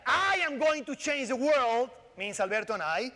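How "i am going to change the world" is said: slow, loud, higher, big-statement voice; grandiose proclamation. Shown as a demonstration. Joking about his co-author, a grand mock boast. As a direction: proclaim it like a hero, slowly. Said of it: "in a sentence like".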